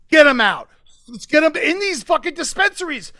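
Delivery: a sudden loud call to free them; a rally shout demanding release. Real, not acted. Rallying cry for prisoners' release.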